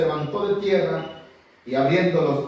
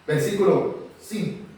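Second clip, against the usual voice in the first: usual speaking voice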